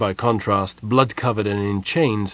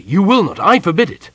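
higher, louder royal command; forbidding loudly; 10 semitones higher, 8 dB louder than usual. A narrator's reading. King to general, loud forbidding command. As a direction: lay down the law.

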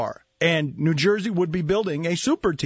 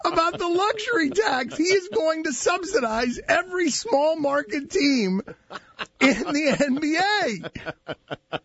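laughing; 10 semitones higher than usual. Real, not acted. Laughing through his rant.

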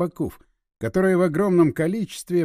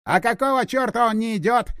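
high, raised angry retort; growling; 4 semitones higher, 3 dB louder than usual. A narrator's reading. Vyacheslav snaps at Irina while driving, angry growling. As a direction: snap it higher, in irritation. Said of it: "прорычал Вячеслав".

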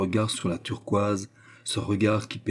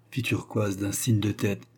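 plain reading voice

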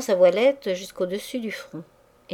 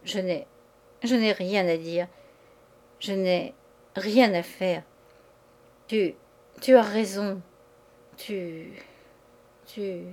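halting, broken phrases with long pauses; stammering. A narrator's reading. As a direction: falter and restart, words failing. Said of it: "elle balbutia".

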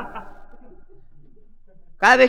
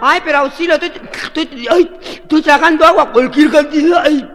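high, loud, spluttering plea; hammed-up gasping cries for help; mock drowning panic; 10 semitones higher, 7 dB louder than usual. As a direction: beg while swallowing water. Drowning man gulping water, pleading.

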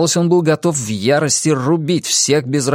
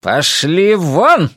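drawn-out, raised order to leave; very slow, spiteful command; angry snap; 5 semitones higher, 4 dB louder, much slower than usual. A narrator's reading. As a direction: drive them off furiously. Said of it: "злобно огрызнулся Гийом".

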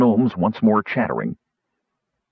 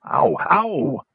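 pained yelp; 10 semitones higher than usual. A narrator's reading. Gnome whose fingers are being pried loose, pained yelp. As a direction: yelp in pain, pitch jumping up. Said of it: "Roperig yelled".

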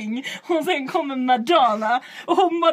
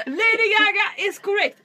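higher, loud announcing host; quizmaster voice; 9 semitones higher, 6 dB louder than usual. Retold or imitated. Quiz host declaring the answer correct, announcer style. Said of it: "Och han bara".

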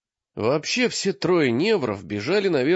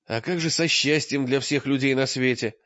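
ordinary delivery, nothing marked

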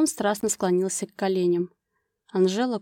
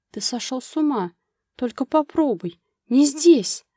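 higher-pitched protest, building louder; moaned protest; 5 semitones higher, 4 dB louder than usual. A narrator's reading. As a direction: protest in rising alarm to a loud plea. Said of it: "простонала Анжела". (протестующий стон, всё выше и громче)

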